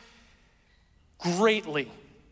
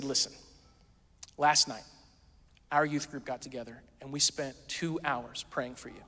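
earnest; 5 semitones lower, 7 dB quieter than usual. Retold or imitated. Ninth grade girls to him, soft earnest sincerity. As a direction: lower and gentle, heartfelt.

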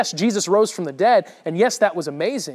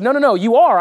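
louder, higher, cutting in on the denial; insistent accusation; 5 semitones higher, 8 dB louder than usual. Retold or imitated. Bystander contradicting Peter's denial, insistent. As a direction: insist firmly, pitched up and louder.

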